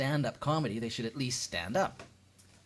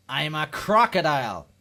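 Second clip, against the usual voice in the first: loud, slow mock-offended retort; drawn-out outburst, biting their head off; snapping indignantly; 3 semitones higher, 11 dB louder than usual. Crocodile correcting a stranger, loud slow indignant snap. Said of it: "they seem to bite your head off".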